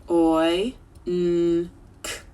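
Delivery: segmented Oi, n, k; stretched sounds. Shown as a demonstration. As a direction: sound out oink piece by piece. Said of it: "Let's stretch it".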